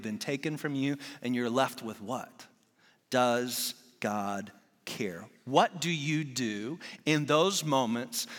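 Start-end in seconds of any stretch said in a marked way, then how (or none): 3.1-5.2 drawn out, lower, every word heavy; slow and weighty. Acted. Preacher asks the congregation slowly and heavily. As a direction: let the question hang, very slow. Slowed rhetorical question in sermon.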